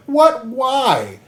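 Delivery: high, incredulous yelp; mock dismay. Guts told he has to go, in mock dismay. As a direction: voice leaps up in exaggerated disbelief. Acting Guts's reaction to being sent off.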